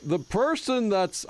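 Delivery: very slow, stretched Texas drawl; bad fake Texas accent. Streamer trying out a fake Texas accent, drawn-out. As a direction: stretch out a fake Texan twang. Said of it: "gonna do the bad fake Texas accent".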